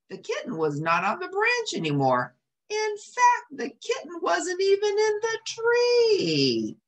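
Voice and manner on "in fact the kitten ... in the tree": high, slow storybook reveal; suspenseful reveal. A narrator's reading. Reading to children, a dramatic slow reveal. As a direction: build the surprise for kids.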